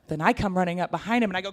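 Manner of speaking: ordinary talk, nothing marked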